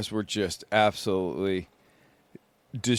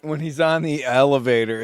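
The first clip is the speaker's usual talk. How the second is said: normal talking voice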